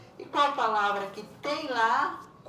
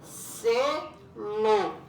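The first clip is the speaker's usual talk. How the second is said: "Selo" in two firm beats, louder; split into syllables; 5 dB louder than usual. Shown as a demonstration. Teacher answering her own question, "Selo" split firmly in two. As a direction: punch out "Selo" as se, lo. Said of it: "que tem duas sílabas".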